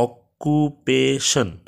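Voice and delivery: "Occupation" very slow, each syllable set apart. Shown as a demonstration. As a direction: break "occupation" into four drawn-out syllables. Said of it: "এখানে কয়টা আছে?".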